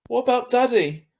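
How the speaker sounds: high, loud desperate cry; cried out. A narrator's reading. Boy crying out to Mum blocking the door, high and loud. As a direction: cry out for Daddy. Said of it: "I cried".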